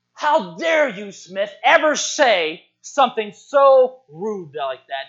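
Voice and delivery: slow, drawn-out indignant scolding; mock outrage. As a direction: act scandalised, leaning on each word. Outraged men confront Smith.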